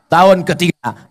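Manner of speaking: ordinary talk, nothing marked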